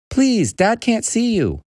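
high-pitched, drawn-out plea; urgent pleading. Acted. Boy hiding the creature from dad, urgent pleading. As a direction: beg urgently in a high boy's voice.

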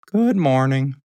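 slightly high cow greeting; lightly voiced cow. A narrator's reading.

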